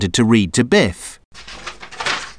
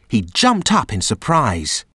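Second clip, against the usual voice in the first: ordinary delivery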